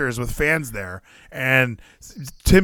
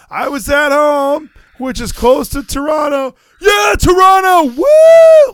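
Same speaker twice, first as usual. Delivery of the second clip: much higher and louder, slow hyped promo; hammed-up promo shout; 12 semitones higher, 10 dB louder, slower than usual. Mimicking Josh Alexander playing to the crowd, loud hammed-up shout. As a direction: yell it like a hyped wrestler ending on a woo. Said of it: "Josh is like".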